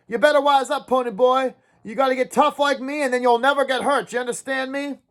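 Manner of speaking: high, urgent ranting to a dazed friend; raving rant. Acted. Dally raving at Ponyboy in the car, high and urgent. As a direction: spill it all out feverishly. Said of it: "kept raving on and on".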